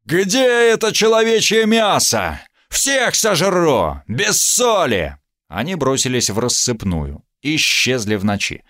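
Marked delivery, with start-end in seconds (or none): 0.0-5.2 huge high roaring monster threat; berserker roar. A narrator's reading. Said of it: "заорал страшным голосом".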